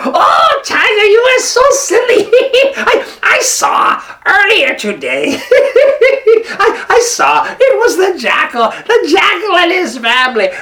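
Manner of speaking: very high, loud monkey voice through laughter; mock the silly tiger; laughing monkey. Monkey laughing at the fooled tiger, high and loud.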